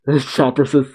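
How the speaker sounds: usual speaking voice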